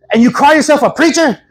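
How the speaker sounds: high, mock-indignant challenge; angry little lady. Offended woman challenging the pastor, mock indignant. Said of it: "Angry little lady, man, very angry".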